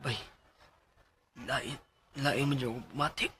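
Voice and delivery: soft, slow, labored words; feeling faint. Acted. Friend tells coworker he feels sick, faint. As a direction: struggle to get it out as sickness hits. Falling ill during the game.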